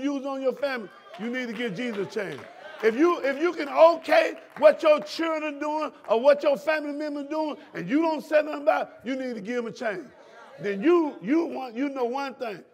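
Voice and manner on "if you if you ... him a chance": high, loud and driving; preaching at full force. Acted. Preacher rebuking the congregation's families, loud and rising. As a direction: preach it hard at the pews.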